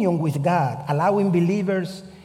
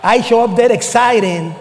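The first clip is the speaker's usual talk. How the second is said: a burst of loud, higher excitement; excited; 5 semitones higher, 10 dB louder than usual. Retold or imitated. Preacher telling the congregation about the lake, excited. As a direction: build up the thrill, then drop the joke. Excited arrival, comic letdown.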